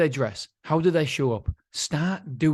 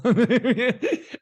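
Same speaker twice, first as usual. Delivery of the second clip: nervous laugh; 10 semitones higher, 5 dB louder than usual. Real, not acted. Laughing at his own nerves.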